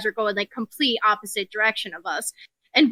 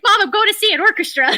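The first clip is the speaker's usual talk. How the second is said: higher-pitched mock pleading with mom; exasperated protest; 10 semitones higher, 4 dB louder than usual. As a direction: insist like a kid who isn't believed. Retold protest after mom doubts her.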